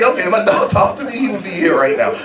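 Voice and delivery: ordinary delivery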